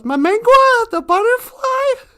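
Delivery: high-pitched, puzzled disbelief; surprised question. Acted. Little cutie to the fox on hearing of the butterfly, surprised. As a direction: repeat the name in high astonishment.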